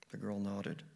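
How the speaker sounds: plain reading voice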